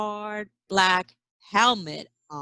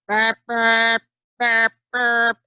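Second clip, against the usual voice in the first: horn sound; 5 dB louder than usual. Acted. Imitating truck horn.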